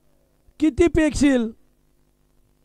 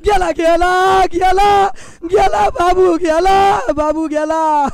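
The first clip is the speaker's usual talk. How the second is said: anguished cry; 4 semitones higher, slower than usual. Real, not acted. Commentator crying out as Babu is run out. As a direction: cry out as the wicket falls.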